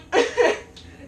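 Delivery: bright burst of laughter; high laugh. Real, not acted. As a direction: laugh out at yourself. Laughs at own joke.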